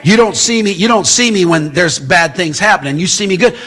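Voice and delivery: ordinary talk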